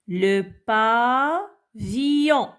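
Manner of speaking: slow Le pa - villon, pa held; syllable by syllable. Shown as a demonstration. Teacher cutting words up for children, pavillon slowly split. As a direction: break pavillon apart, falling on villon. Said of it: "découper les mots en syllabes".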